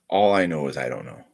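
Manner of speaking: ordinary delivery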